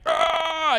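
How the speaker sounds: high, drawn-out cry of pain; strained "ah" as the arm hurts; acted yelp of pain. Acts out arm test pain.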